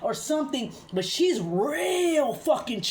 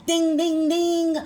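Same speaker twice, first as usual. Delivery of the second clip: loud, higher ringing imitation of a fight bell; boxing bell; 6 semitones higher, 7 dB louder, slower than usual. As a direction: ring it out like the bell at round one.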